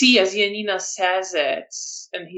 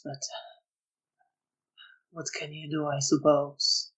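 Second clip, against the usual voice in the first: soft, halting character voice; guilt-ridden man, resigned; 3 semitones lower, 9 dB quieter than usual. Acted. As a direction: let it out quietly, with long pauses.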